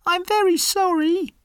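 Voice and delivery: very high tiny mouse voice; apologetic squeaky cartoon mouse; scared little mouse. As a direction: pitch way up like a frightened little mouse. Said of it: "says the mouse".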